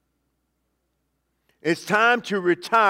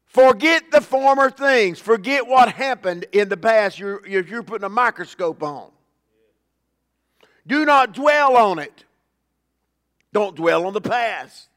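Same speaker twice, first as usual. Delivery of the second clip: loud, emphatic scripture proclamation; forceful preaching; 3 semitones higher, 4 dB louder than usual. Real, not acted. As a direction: preach it hard, driving each command home.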